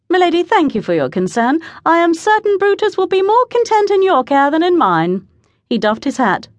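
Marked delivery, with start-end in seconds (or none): 0.1-5.3 courteous calling down. A narrator's reading. Man calls down polite thanks.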